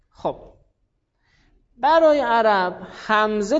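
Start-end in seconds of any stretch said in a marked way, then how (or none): none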